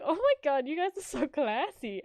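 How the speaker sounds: gushing. Retold or imitated. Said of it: "she was like".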